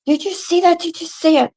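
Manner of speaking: high, breathless "did you see that"; excited disbelief. Retold or imitated. Woman urging her boyfriend to confirm the creature, high and excited.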